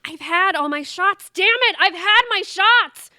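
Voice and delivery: loud, high exasperated yelling; angry outburst. Acted. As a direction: blow up at the nagging family. Sister snaps at nagging family.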